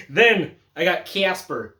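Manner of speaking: slow, drawn-out Midwestern take on Casper; slow, flat-vowel Midwestern delivery of "Casper"; Midwestern accent. Acted. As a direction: lean into a Midwestern accent, slowly. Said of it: "like a Midwestern motherfucker".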